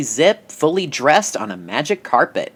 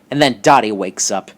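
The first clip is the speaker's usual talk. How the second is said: ordinary talk, nothing marked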